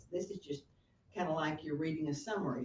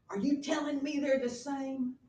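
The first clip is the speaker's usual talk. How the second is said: indignant Hamlet; 8 semitones higher, 8 dB louder than usual. Retold or imitated. Hamlet confronting mother.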